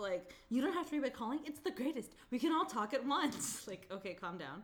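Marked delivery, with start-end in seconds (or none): 0.5-3.7 mock commercial pitch. Mocking commercial voice.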